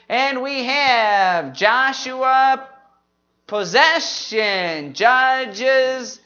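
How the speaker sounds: slow, drawn-out book and key word; group recitation. Shown as a demonstration. Teacher leading the history books, slow group recitation. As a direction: stretch each name so the room can join. Leads the memory list.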